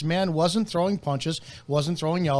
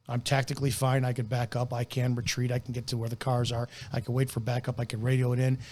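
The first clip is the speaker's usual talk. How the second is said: quiet inner voice; 6 semitones lower, 6 dB quieter than usual. Retold or imitated. Voicing the officer's possible thoughts.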